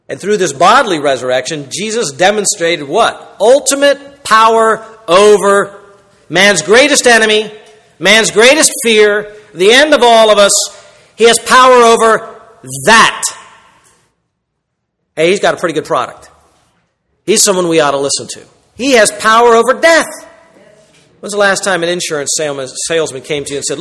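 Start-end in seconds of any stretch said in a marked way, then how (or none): 3.4-13.2 slow, loud pulpit climax; forceful preaching. Real, not acted. Preacher proclaiming power over death to congregation, forceful. 18.8-20.1 forceful preaching. Real, not acted. Preacher proclaiming power over death to congregation, forceful.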